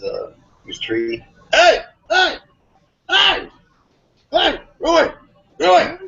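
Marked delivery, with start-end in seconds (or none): 1.5-6.0 high, loud yells of hey; scaring off a bear. Retold or imitated. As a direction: shout high to chase a bear off. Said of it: "who's yelling at that bear".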